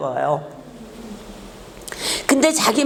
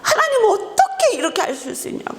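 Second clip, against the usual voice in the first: high-pitched crying plea to God; tearful protest; 10 semitones higher than usual. Retold or imitated. Pastor reenacting arguing with God at the funeral, a tearful high plea. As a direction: cry out the question much higher, like a child's tantrum. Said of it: "울면서 땡깡 부리면서".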